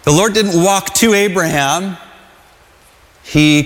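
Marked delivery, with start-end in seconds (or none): none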